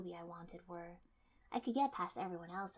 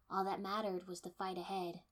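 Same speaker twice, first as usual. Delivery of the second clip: plain reading voice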